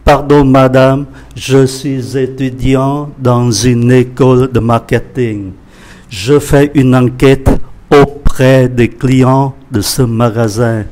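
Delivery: loud, slow, carefully spaced French; slow reading. Shown as a demonstration. Teacher reads the survey dialogue to students, slow reading. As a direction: read each phrase out slowly and firmly for learners. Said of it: "Tôi đọc chậm nhé".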